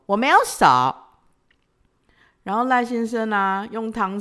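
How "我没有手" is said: high, loud cheeky tease; playfully. Retold or imitated. Ordering boyfriend to feed her, cheeky and loud. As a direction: say it like a naughty child. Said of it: "我就调皮的说".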